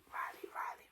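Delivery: soft, breath-only ASMR whisper; whispered name repetition. Shown as a demonstration. ASMR host lingering on a viewer's name, whispered.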